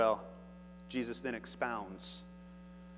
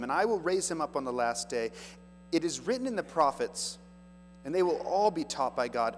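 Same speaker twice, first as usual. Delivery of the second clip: raised, louder preaching read; scripture reading; 4 semitones higher, 4 dB louder than usual. Acted. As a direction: proclaim the verse to the congregation. Reads Jesus' words.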